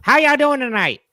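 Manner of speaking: hammed-up stage magician hello; David Copperfield impression. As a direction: play a magician greeting the crowd, up high. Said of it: "this is my impression of David Copperfield".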